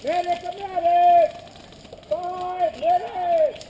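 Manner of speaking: loud, drawn-out call; man down shout. Real, not acted. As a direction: holler for a medic. Calling for medic, drawn out.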